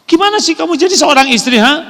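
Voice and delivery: high, loud, fast scolding husband; angry husband. Retold or imitated. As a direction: berate the wife. Suami memarahi istri. (suami membentak istri dengan marah)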